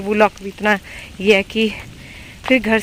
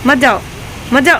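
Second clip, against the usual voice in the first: higher, loud urgent warning; real raised-voice warning; urgent calling out; 5 semitones higher, 8 dB louder than usual. Real, not acted. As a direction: call out to stop them over the rain. Warning someone off in rain.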